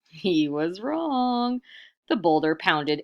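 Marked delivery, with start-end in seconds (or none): 0.1-1.6 very slow and emphatic; ominous slow line. A narrator's reading. Narrator slowly delivers the bad news, very slow. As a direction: let the doom land.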